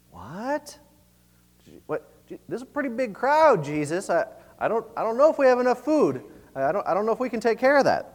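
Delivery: acted bewilderment with halting restarts; confused disbelief. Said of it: "were obviously very, uh, confused by this".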